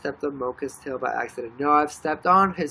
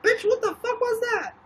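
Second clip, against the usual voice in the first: much higher, squeaky indignant pet voice; voicing the dog; 3 dB louder than usual. Owner voicing her dog's outrage at being hit, squeaky high voice. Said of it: "He was like".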